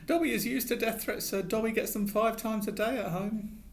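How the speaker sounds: high squeaky elf voice, anxious; a fretful little elf begging; pleading house elf. A narrator's reading. Dobby smiling weakly.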